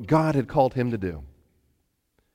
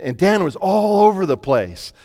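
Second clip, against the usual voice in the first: ordinary delivery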